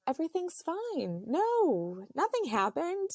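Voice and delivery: high mock denial; retold denial. Wife denying to herself her water broke, high-pitched denial. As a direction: wave it off in a high voice.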